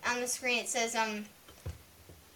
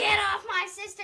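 shouting; 8 semitones higher than usual. Retold or imitated. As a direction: yell it protectively. Retold yell at Bob.

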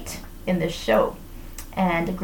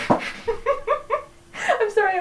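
high, slow, laughing through words; 11 semitones higher, much slower than usual. Real, not acted. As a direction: apologise while giggling. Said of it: "I was laughing".

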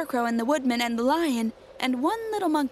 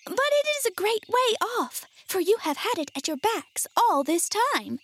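high, tiny mouse-queen voice; small squeaky storybook queen; squeaky little voice; 10 semitones higher than usual. A narrator's reading. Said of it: "in her squeaky little voice".

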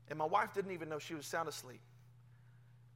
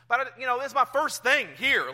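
eager protest; 5 semitones higher, 10 dB louder than usual. Retold or imitated. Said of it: "I'm like".

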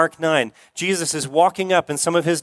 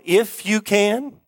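higher, slow, rising disbelief; incredulous echo; 5 semitones higher, slower than usual. Retold or imitated. Jesus echoing the father's doubt with gentle incredulity. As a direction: turn it into an amused, unbelieving question. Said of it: "You can imagine a little smile on his face".

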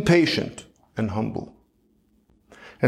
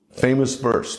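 ordinary delivery

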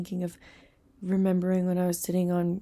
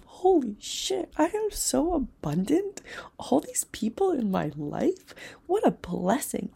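much higher, slow and drawn out in awe; amazed inner cry; 7 semitones higher, slower than usual. Retold or imitated. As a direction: stretch it out, overwhelmed with wonder. Retelling sudden overwhelming realization.

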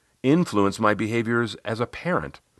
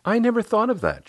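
much higher voice of a coaching client; Riley. Retold or imitated. Client reacting to her coach's praise, much higher voice. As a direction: client taking in a new thought, high-pitched. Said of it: "she said".

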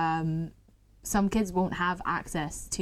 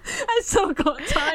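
laughing; 12 semitones higher, slower than usual. Real, not acted. Flustered, laughing, still timed.

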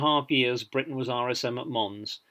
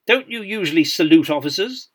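lightly hammed new officer's accent, raised pitch; self-important new British officer's accent; 5 semitones higher, 7 dB louder than usual. A narrator's reading. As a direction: put on a newly commissioned officer's accent. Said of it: "in his new officer's accent".

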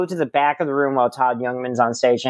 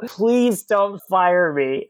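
high, slow mock begging; retold pleading; 5 semitones higher, much slower than usual. Host begs club owner for his job, high drawn-out pleading. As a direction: beg slowly, pitched up.

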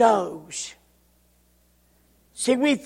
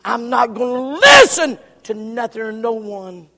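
loud, stubborn refusal; loud defiant refusal; defiant shout; 9 dB louder than usual. Acted. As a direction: shout it like a rebel.

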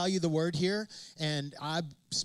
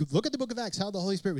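ordinary talk

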